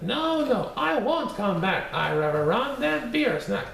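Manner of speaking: high, sing-song taunting cookie voice; bouncy little gingerbread man bragging; cheeky gingerbread man. Acted. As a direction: tease them from far out of reach. Gingerbread man refuses again.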